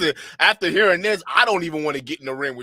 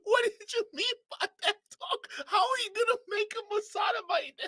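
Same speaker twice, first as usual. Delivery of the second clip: laughing disbelief; 5 dB quieter than usual. Real, not acted. Streamer still laughing in disbelief.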